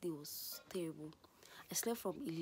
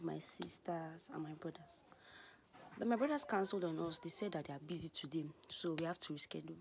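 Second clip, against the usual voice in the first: ordinary talk, nothing marked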